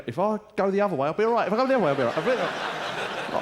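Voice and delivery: put-on high, strained drunk talking himself upright; a pissed bloke steadying himself at the bar, swaying self-talk; drunk inner voice. Drunk at the bar reassuring himself, high strained voice. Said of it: "most of the thoughts are going".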